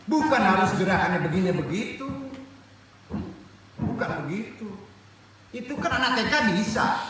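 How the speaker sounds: high, loud, insistent correction; forceful preaching. Real, not acted. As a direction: hammer the point home to the congregation.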